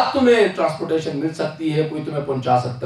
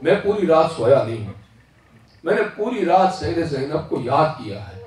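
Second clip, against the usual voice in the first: ordinary talk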